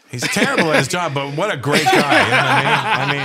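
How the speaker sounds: real laughter breaking up the line; laughing. Real, not acted. Laughing at his own joke.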